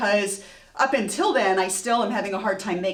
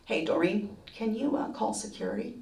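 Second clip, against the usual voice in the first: understated, hesitant murmur; calm boss on intercom; 7 dB quieter than usual. Retold or imitated. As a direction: imitate a boss quietly summoning help.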